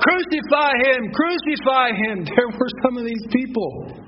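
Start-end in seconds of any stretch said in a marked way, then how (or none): 0.0-2.2 higher, louder crowd chant; imitated crowd's shout; crowd crying out. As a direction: cry it out like an angry mob. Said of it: "that was crying out".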